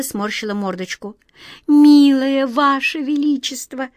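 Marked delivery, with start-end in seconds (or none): 1.7-3.9 медленно, дрожащий, умоляющий голос; голос дрожит от волнения; дрожащим голосом. A narrator's reading. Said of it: "дрожащим голосом сказала Ниточка". (дрожащим голосом)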